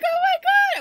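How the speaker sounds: high, loud fangirl cry; excited squeal. Retold or imitated. Fans so excited during the picture, high squeal. Retells group's excited reaction.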